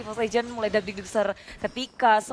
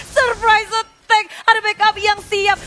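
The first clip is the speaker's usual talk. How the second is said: high, slow, drawn-out hype; excited call; 7 semitones higher, 6 dB louder, slower than usual. Real, not acted. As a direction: stretch the call out. Caster calls a surprise attack.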